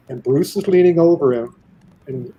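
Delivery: ordinary delivery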